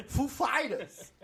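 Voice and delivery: "Foo Fighters" drawn out, weight on "Fighters"; stress on Fighters. Retold or imitated. Imitating [name] naming the band, stress on "Fighters". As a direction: slowly land on "Fighters" in "Foo Fighters". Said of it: "It's on the Fighters".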